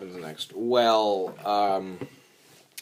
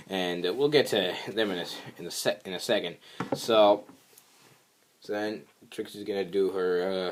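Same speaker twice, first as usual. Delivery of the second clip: ordinary talk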